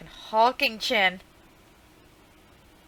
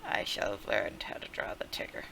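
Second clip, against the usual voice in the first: whisper; 12 semitones lower, 9 dB quieter than usual. Acted. Dark vow turned secret.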